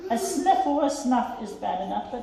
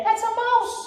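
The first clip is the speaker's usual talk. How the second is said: high, pleading, scared elephant voice; frightened elephant; 6 semitones higher than usual. Acted. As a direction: beg the mouse not to scare you. Elephant panics at the mouse.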